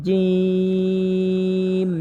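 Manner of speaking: জিম pressed hard, held long; firm drawn-out জিম; hard jim. Shown as a demonstration. Teacher showing students the hard জিম, held long. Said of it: "তাহলেই শক্ত হবে".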